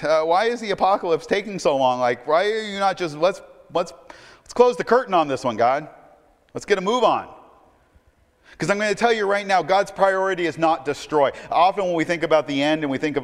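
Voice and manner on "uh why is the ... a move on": high, loud mock impatience; raised, exasperated plea played for effect; facetious impatience. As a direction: hurry God along, half-joking. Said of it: "maybe I'm being facetious".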